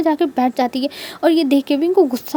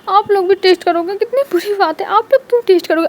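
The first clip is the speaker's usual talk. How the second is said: put-on childish protest; 5 semitones higher than usual. Child Wing indignant about sharing.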